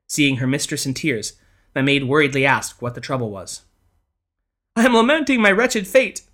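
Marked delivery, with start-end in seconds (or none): 4.8-6.2 high tearful lament, louder; sobbing lament. A narrator's reading. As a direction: sob it out mournfully. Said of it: "sobbed the lady".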